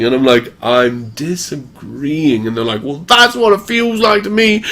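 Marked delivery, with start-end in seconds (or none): 0.6-2.5 very slow, softer, stretched words; slow-motion disagreement; drawn-out retort. Retold or imitated. Acted reply to mocking accusation. 2.8-4.6 high-pitched imitation of a complainer; whiny retort, mockingly imitated; mock aggrieved accuser.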